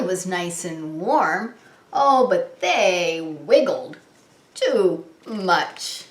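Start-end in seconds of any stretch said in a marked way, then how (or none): none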